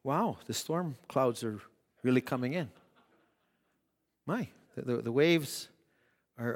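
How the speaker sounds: mock calm disciple talk; calm quiet disciple. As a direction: murmur it like nothing is wrong. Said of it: "I don't imagine the discussion was like this".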